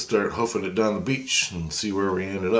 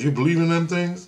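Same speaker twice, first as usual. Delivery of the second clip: high-pitched mock disbelief; incredulous buddy; 10 semitones higher, 3 dB louder than usual. Buddy doubting a UFO question, incredulous. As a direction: ask it as a retold sceptic, pitch jumping up.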